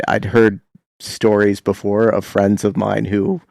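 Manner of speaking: normal talking voice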